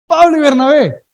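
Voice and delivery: Pablo y Bernabé, with a loud, high punch on Pablo; emphatic swap. Acted. Preacher announcing the new order of names, Pablo punched loud.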